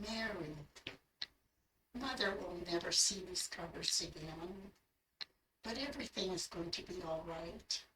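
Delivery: soft, hushed voice bringing a message; beautiful voice. Retold or imitated. A heavenly voice gives Mary news of Mother, softly. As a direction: deliver it softly, with quiet reassurance. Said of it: "that beautiful voice".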